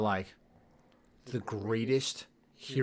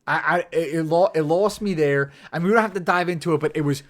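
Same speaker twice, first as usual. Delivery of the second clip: higher, louder, rushing worked-up rant; real outburst over a plot hole; excited; 7 semitones higher, 10 dB louder, faster than usual. Real, not acted. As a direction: blurt it out, fired up and fast. Heated rant about the shield.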